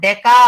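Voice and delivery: "డెకా" with a long, emphatic final aa; model pronunciation. Shown as a demonstration. Teacher showing students how to say the prefix, "డెకా" emphatic. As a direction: pronounce "డెకా" clearly, drawing out the end. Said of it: "అని పలకాలి".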